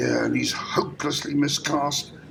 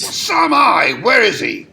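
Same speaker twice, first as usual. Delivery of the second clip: loud, high, impatient outburst; exasperated shout; angry demand; 5 semitones higher, 6 dB louder than usual. Acted. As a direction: cut her off and demand answers. Distraught director demands missing actor.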